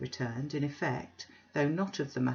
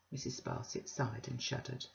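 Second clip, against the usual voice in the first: usual speaking voice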